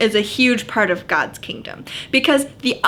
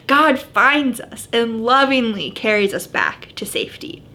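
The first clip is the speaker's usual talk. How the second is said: normal talking voice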